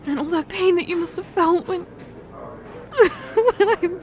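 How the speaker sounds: high, broken, tearful voice; crying. Acted. Admitting the truth to her partner, crying. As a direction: fight through the tears to say it.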